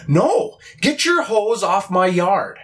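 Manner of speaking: imitating the angry homeowner protecting her lawn; indignant lady. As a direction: play the snooty lady scolding him off her lawn. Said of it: "she comes out yelling at me".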